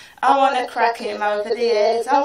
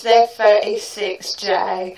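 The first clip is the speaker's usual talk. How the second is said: ordinary delivery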